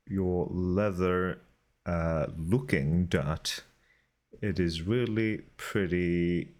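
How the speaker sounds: soft, close ASMR murmur; hushed and gentle, like ASMR. Acted. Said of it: "A S M R is now over".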